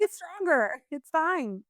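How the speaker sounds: high-pitched mock parental dismissal; mock tough love. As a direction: playfully push scared kids to toughen up. Mock parent scolding scared kids.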